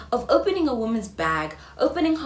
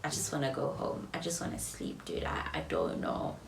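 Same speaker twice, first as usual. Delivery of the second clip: soft, tired, fading; defeated at the gate after the date; weary defeated; 5 semitones lower, 9 dB quieter than usual. Retold or imitated. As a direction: say it exhausted. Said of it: "I remember saying".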